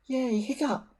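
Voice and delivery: put-on high, bright boyish cheer. A narrator's reading. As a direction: cheer your friend on from the side. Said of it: "cheered Fishlegs".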